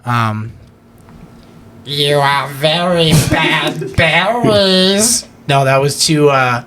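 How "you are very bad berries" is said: overly nasal character voice. Acted. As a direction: squeeze it through the nose, slowly. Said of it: "too in my nasal".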